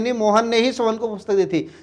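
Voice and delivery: ही pressed with a falling tune; emphatic ही, nobody else gave it; stress on ही. Shown as a demonstration. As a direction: insist on ही, as if correcting someone. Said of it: "wishes focus करके बोल रहे हैं".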